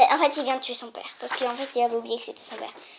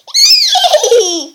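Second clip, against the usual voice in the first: high whinnying laugh; horse whinny laugh; 11 dB louder than usual. Acted. Oedipus's horse whinnying with laughter. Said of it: "Le cheval d'Oedipe".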